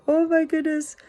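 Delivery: high, worried voice; anxious. Retold or imitated. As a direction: fret aloud, panicked. Anxious thought about her cat.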